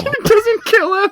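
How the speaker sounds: very high, loud laughing words. Real, not acted. Cop player laughing that the neck twist doesn't kill, high.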